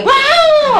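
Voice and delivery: very high comic shriek; mock startled scream. Husband hugging wife at bedtime, mock startled high scream. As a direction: imitate a husband screaming in fright. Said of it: "dia teriak".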